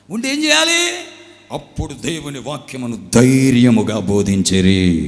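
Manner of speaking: loud, slow pulpit proclamation; preacher at full force; fiery preaching. Acted. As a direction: thunder it to the hall.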